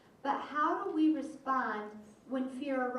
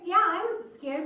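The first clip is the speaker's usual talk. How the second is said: louder, higher confession; emphatic admission; 6 semitones higher, 9 dB louder than usual. Retold or imitated. Pastor admitting fear to his friend, emphatic. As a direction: blurt it out with force. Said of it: "I said".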